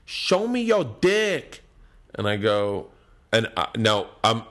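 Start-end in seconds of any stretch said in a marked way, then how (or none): 0.1-1.6 put-on higher, slow drunk girl call; drawn-out, higher girl from the crowd; heckling girl. Drunk girl heckles comic from audience, slow and higher. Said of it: "some girl goes".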